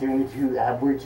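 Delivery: ordinary delivery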